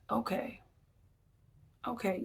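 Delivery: quiet, resigned okay, okay; soft knowing okay. Shown as a demonstration. To a spouse after losing the argument, soft resigned okay. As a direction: let it drop, a soft defeated okay. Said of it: "you know the okay".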